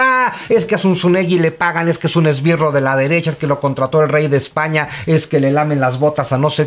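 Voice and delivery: high, indignant whine of a hater; mock outraged commenter. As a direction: parody an online critic rattling off accusations. Mimics angry disagreeing commenters.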